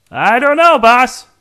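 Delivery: usual speaking voice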